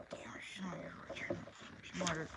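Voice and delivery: whispered to herself; whispered aside. Acted. Tanessa's private thought on missing Jessica: whispered aside. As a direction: breathe it out as a secret whisper.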